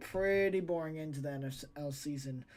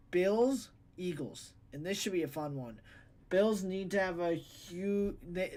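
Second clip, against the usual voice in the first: ordinary delivery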